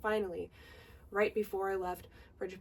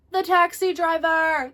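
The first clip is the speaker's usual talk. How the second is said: high, loud, slow triumphant announcement; excited reveal; 8 semitones higher, 11 dB louder than usual. Real, not acted. Telling viewers who answered her phone, big excited reveal. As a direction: land the punchline big and drawn out.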